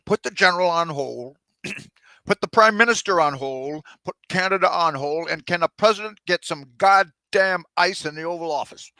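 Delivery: loud, impatient commands one after another; barking demands. Acted. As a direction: snap orders at the staff. President barking demands down the line.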